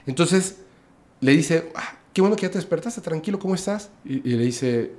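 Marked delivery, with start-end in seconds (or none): 1.8-3.9 higher, quick and soothing, easing someone awake; a light, gentle take on a big man calming a hurt guest; reassuring stranger. Retold or imitated. Tall stranger reassures the man who just woke, gently voiced.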